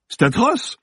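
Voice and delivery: very high appalled exclamation; horrified. Acted. Actor seeing the dead man's photo, horrified. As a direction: recoil with the voice pitched right up.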